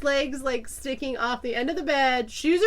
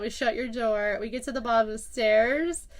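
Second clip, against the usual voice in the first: ordinary talk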